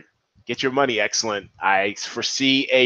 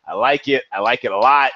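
normal talking voice

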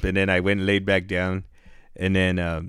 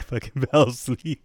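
laughing; 4 semitones higher than usual. Real, not acted. Laughing while telling the story.

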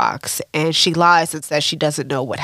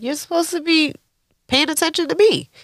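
high-pitched mock indignant girlfriend; put-on high voice demanding attention; indignant complaint; 10 semitones higher, faster than usual. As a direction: imitate a miffed lover, pitched way up. Voicing Zisa's complaint to Lou.

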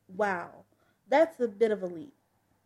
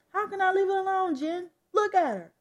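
high, loud, quick argument; heated protest; 8 semitones higher, 5 dB louder than usual. Acted. Dad arguing with his wife about their daughter, heated. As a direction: fire back, pitch jumping up.

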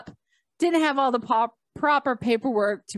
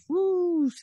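high, airy swoosh of a rising gate arm; imitated whoosh; 9 semitones higher than usual. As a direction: mimic the gate swinging up.

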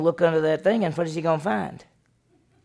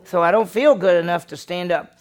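louder, pleading 'feel'; raised excuse; 3 semitones higher, 8 dB louder than usual. Retold or imitated. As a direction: press the excuse out loudly. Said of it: "What did she say?".

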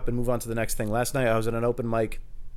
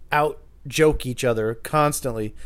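ordinary delivery

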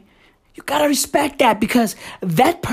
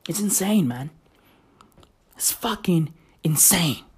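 unvoiced hushed aside; whisper; 4 semitones lower, 6 dB quieter than usual. Real, not acted. Streamer whispering disbelief about American politics.

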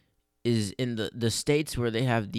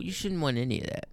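ordinary talk

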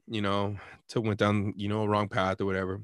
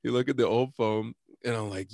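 ordinary talk, nothing marked

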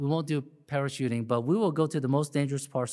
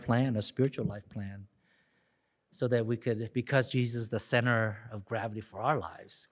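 closing prayer; 4 semitones lower than usual. Acted. Pastor leads closing prayer.

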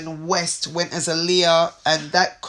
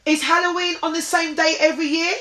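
repeating to voice assistant; 9 semitones higher than usual. Real, not acted. Asking Google, raised pitch.

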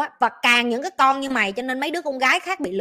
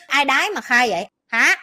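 ordinary talk, nothing marked

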